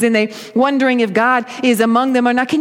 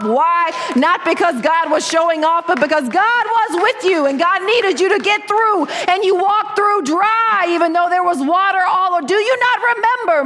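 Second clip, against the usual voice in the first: climbing higher and higher to a preaching shout; rising to a shout; 7 semitones higher than usual. Real, not acted. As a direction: build it up until you're crying out. Preacher building to climax.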